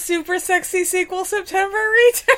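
laughing through a jokey intro. Real, not acted. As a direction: giggle through the title.

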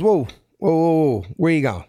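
a rough South Philly guard's challenge; a hint of a South Philly accent. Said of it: "I already hear the South Philly accent".

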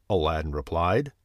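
ordinary delivery

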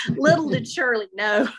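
real laughter caught in the words; amused laughing. Real, not acted. Narrator amused by Shirley's shoe remark, laughing. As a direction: start the line before the laugh is over.

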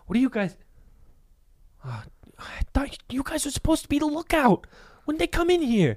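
put-on high hissed whisper of a scolding kid; whispering older kid. Oldest orphan whispering at the lookout kids, high and hushed. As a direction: scold the lookouts under your breath. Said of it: "he turns, he goes".

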